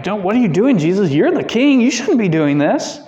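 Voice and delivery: mock embarrassed disciple; embarrassed Peter. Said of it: "somewhat of an embarrassment".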